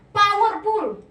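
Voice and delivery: loud, high punch; forceful emphasis. Real, not acted. Preacher explains the lion as symbol, forceful. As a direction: hit it hard and high.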